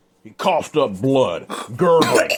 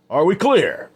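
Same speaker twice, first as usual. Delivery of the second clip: much higher and louder, barking the question; loud repeated demand; 9 semitones higher, 6 dB louder than usual. Acted. Adam repeats it to the men, a loud demand.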